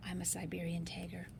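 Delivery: hushed whispered reveal. Acted. Reading to a child, whispering the answer.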